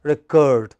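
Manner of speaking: reCORD, as the verb; light "re", strong CORD in "record"; second-syllable stress. Shown as a demonstration. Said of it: "it is the second syllable which is stressed".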